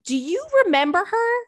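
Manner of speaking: spring it on them, high and keen; excited recall. Real, not acted. Recalls the bunny email to listeners.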